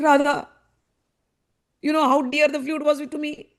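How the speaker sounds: hammed-up heartbreak without sobbing; almost in tears. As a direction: plead emotionally, building in pitch and force. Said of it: "he's almost in his tears".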